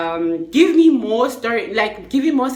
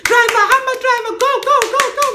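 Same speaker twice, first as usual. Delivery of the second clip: high, loud urging to go; urgent shouting; 6 dB louder, much faster than usual. Retold or imitated. The ladies urge the taxi driver to leave, loud and high. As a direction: hurry the driver on. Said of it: "They're like".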